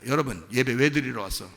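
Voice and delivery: ordinary talk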